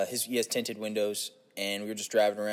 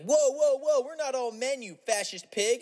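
high, indignant mock objection; mock outrage; 13 semitones higher, slower than usual. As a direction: cut in with hammed-up, high-pitched offence. Acted outraged objection to the hippie.

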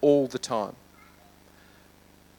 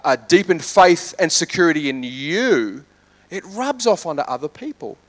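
normal talking voice

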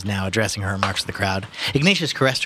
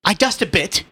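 pompous voice; 11 semitones higher, 4 dB louder than usual. Acted. Ignatius voiced in reading.

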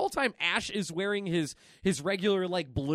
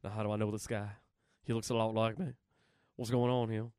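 lightly voiced [name] thinking aloud; mumbling [name] impression; [name] musing; 12 semitones lower, 7 dB quieter, faster than usual. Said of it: "he's like".

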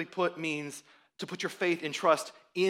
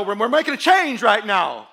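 loud; 4 semitones higher, 11 dB louder than usual. Retold or imitated. God voiced as quarterback.

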